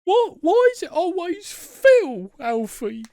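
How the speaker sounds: loud, very high kiddie voice, drawn out; Milo from the Tweenies. Acted. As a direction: whine like a cartoon little boy. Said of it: "You sound like Milo from the Tweenies".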